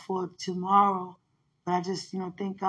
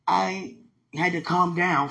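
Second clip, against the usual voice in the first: usual speaking voice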